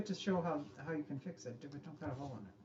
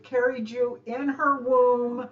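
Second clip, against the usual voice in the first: mock solemn; 4 semitones higher, 11 dB louder, slower than usual. A mock-solemn Mother's Day tribute.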